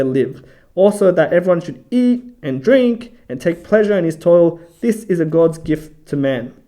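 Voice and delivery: scripture reading. Acted. Bible study host reading a verse to listeners, scripture reading voice. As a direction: read the verse out firmly and deliberately.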